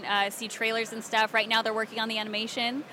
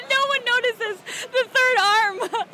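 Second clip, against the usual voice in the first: higher, louder, drawn out through laughter; laughing; 13 semitones higher, 5 dB louder, slower than usual. Real, not acted. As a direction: say it through real giggles. Laughing at three-armed character.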